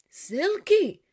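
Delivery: high, loud startled question. A narrator's reading. Oscar hearing the bad news, startled and loud. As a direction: jolt up in alarm. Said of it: "asked Oscar, startled".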